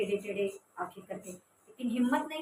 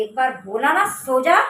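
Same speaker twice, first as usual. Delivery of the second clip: loud furious command; angry shout; 14 dB louder than usual. Retold or imitated. Sister shouting at the siblings to sleep, angry shout. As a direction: yell it as a final warning. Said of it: "वो उसका चिल्लाना सुना".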